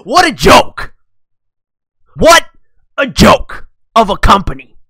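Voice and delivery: loud, punched scorn; loud derisive shout; scornful exclamation. Real, not acted. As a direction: call the whole thing out. Mocking the company.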